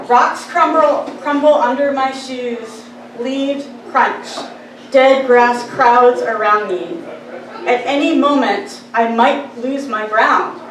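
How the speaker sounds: slow, emphatic poetry recital; measured verse with pauses between images; poem reading. Acted. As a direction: recite deliberately, weighting each word. Reads own poem aloud.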